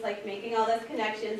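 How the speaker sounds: ordinary delivery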